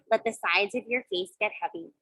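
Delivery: ordinary delivery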